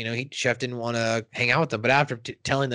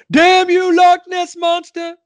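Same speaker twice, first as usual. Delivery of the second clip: put-on much higher, loud Scottish-tinged old man yelling; Chef's dad voice; 5 dB louder than usual. Chef's father curses the Loch Ness Monster, high and hollering. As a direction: shake your fist and holler at the monster. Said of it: "first introduction of".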